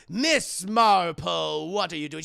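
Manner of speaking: loud, drawn-out name, then reproach; scandalized authority catching an employee; stern president scolding. Retold or imitated. President scolds dancing librarian.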